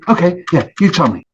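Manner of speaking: ordinary delivery, nothing marked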